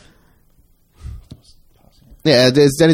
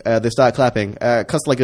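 ordinary delivery

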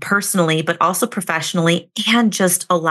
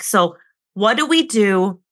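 usual speaking voice

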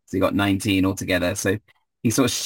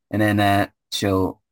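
ordinary talk, nothing marked

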